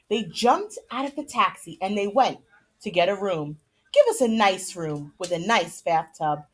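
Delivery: lively storybook narration; reading to children. A narrator's reading. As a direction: read the chapter aloud to the kids with animated character lines. Reads chapter aloud to kids.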